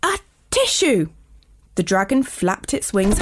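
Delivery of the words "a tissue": high-pitched mock sneeze; a sudden storybook sneeze; acted sneeze. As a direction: sneeze it out as a spoken "atishoo". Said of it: "everyone in the classroom was sneezing".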